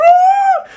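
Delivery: mock panicked scream; laughing mock scream. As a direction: shriek as a terrified kid, laughing. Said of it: "I was just, like, standing there".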